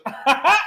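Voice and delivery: high burst of copied laughter; crazy mimic laugh. Automaton imitating the wizard's laugh with a high, loud laugh. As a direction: let the laugh jump up like a faulty copy. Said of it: "a crazy approximation of the wizard's voice".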